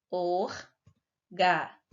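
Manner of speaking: "Organizar" slowly split into or, ga, ni, zar; slow sounding-out of "Organizar" with pauses; syllable by syllable. Shown as a demonstration. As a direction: break "Organizar" into four separate syllables. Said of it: "a sílaba tônica é a última sílaba".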